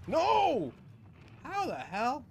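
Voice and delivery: high, loud cry of disbelief; shocked yell. Real, not acted. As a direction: yell no as you get killed. Sudden death in game.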